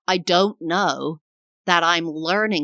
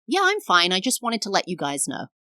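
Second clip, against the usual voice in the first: breezy and light; 2 semitones higher than usual. Shown as a demonstration. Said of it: "hear the way I just said that?".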